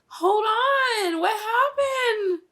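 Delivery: high, loud cry breaking into tears; real crying disbelief; tearful shout. Real, not acted. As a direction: panic and cry at once. Watching favourite character dying.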